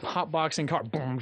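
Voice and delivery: normal talking voice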